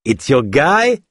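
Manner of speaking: high, slow sneering question in a different voice. A narrator's reading. Thug mocks Ava about Ganesh, sneering. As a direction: sneer it mockingly. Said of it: "ricana le métis".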